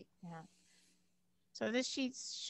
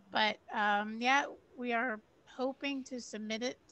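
loud, raised voice for a weak mic; talking really loud; 7 dB louder than usual. Real, not acted. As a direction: keep projecting loudly. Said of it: "I'll just talk really loud".

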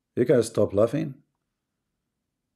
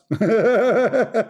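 high burst of mocking laughter; big laugh; 9 semitones higher, 3 dB louder than usual. Real, not acted. Streamer laughing at the caller's argument, loud laugh.

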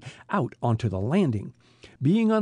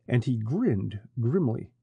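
ordinary delivery

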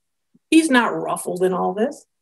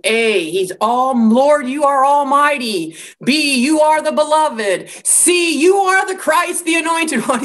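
mock worshipper, climbing higher with each letter; alphabetical praise recital; 5 semitones higher than usual. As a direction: parody a list of lofty praises, building in pitch. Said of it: "go alphabetical khaki and say".

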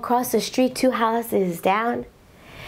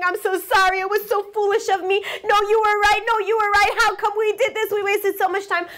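tearful apology; 9 semitones higher, faster than usual. Retold or imitated. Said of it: "both of them are crying and hugging".

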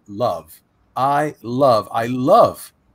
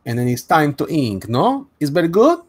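playful mock Italian accent; lilting fake Italian accent, pitch hopping upward; sahte İtalyan aksanı; 3 semitones higher, 3 dB louder than usual. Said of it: "that's in, it's in Italiano".

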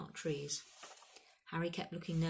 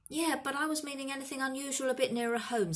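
quick, higher-pitched prodding; impatient; 8 semitones higher, 4 dB louder, faster than usual. A narrator's reading. Hagrid presses the stargazing Ronan, impatient and quick. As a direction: hurry the centaur back to the point. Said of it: "Hagrid watched him impatiently".

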